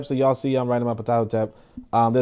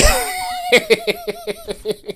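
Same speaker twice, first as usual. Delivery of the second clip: high, wheezy laughing fit; helpless laughter. Real, not acted. Host cracking up at his own accent joke, helpless laughter.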